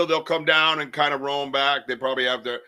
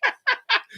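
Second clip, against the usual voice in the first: high laugh. Real, not acted. Laughing at own taunt.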